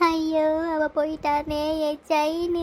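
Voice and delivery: slow, drawn-out wail of loss; stagey tearful lament; acted crying. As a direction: wail helplessly over what was stolen. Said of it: "யார் அந்த அம்மா அப்படி அழுத்திட்டு இருக்கு".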